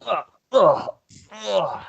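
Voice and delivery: repeated high ughs; exaggerated groans of disgust. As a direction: recoil from a terrible smell. Reacts to terrible camel smell.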